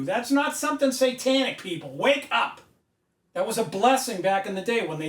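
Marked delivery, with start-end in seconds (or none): 1.9-2.6 sharp wake-up call. Real, not acted. Scolding the audience.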